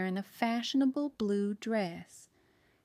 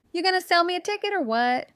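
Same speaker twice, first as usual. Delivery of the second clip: loud, fast, higher snap at the clerk; snapping; 9 semitones higher, 9 dB louder, faster than usual. A narrator's reading. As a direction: bite the words off impatiently. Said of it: "Betty snapped at Jim".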